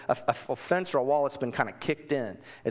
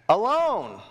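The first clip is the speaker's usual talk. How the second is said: emphatic; 7 semitones higher, 6 dB louder than usual. Real, not acted. Stressing the key word.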